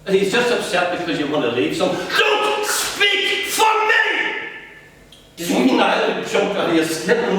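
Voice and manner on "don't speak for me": much higher, drawn out furious shout; screamed. Acted. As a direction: son screaming at mother to stop speaking for him.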